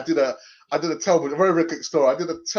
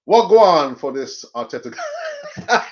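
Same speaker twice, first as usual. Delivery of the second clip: laughing exasperation; 5 semitones higher, 8 dB louder than usual. Real, not acted.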